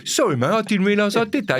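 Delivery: high-pitched imitated apology; apologetic driver.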